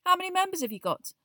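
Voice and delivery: fast, mildly surprised question; mild surprise. Acted. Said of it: "said Ron in mild surprise".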